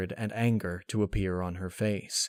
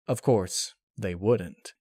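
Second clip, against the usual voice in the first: plain reading voice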